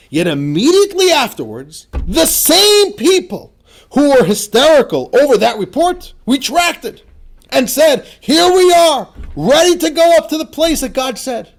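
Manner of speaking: preacher reading scripture at full force; impassioned reading. Acted. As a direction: declaim the passage with fire. Reading the text aloud, fired up.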